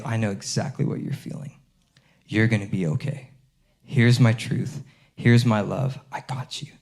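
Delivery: soft, hushed voice of God, fading to a whisper; gentle reassurance. Pretend. God telling a hurting believer he's got them, softly whispered. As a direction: comfort someone in pain, very softly.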